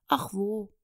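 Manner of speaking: plain reading voice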